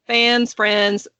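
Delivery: usual speaking voice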